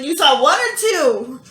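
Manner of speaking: high, loud and slow in disbelief; stunned, asking mom to confirm; shocked question. Retold or imitated. Shocked by twins news, asks mom.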